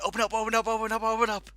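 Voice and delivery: desperate plea to be let in; frantic banging shout. Retold or imitated. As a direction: hammer the words like fists on a door. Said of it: "starts slamming on it, mad to get going".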